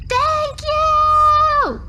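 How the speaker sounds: high loud squeaky cry; cactus shouting. Acted. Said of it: "it shouts".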